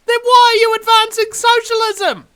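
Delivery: high, loud exasperated retort; exasperated shout. Real, not acted. Confronting socialist protesters, loud and high. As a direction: hurl the question back at them.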